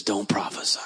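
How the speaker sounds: low, soft whispered warning; the Holy Spirit whispering a warning. Retold or imitated. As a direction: breathe it as an inner voice. Holy Spirit speaks inwardly.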